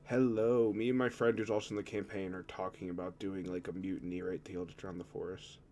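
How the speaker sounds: normal talking voice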